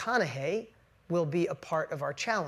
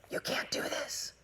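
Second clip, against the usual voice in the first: whispered, softer inner doubting voice; inner villain whisper; 9 dB quieter, slower than usual. Retold or imitated. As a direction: hiss it like the voice of self-doubt. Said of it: "I call it the inner villain".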